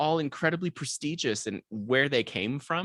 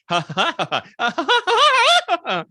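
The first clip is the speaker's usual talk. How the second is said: rising operatic ha ha laugh climbing high; cadenza laugh; 10 dB louder, faster than usual. Shown as a demonstration. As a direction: laugh like a soprano running up the scale. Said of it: "Can we hear a demonstration?".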